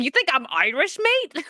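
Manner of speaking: high mock-offended kid with an accent; put-on accent, pitch leaping at the end; accented kid gamer. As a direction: imitate a kid's indignant accented comeback. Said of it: "what accent he was".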